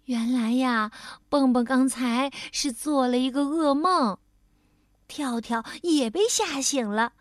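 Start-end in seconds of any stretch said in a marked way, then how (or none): none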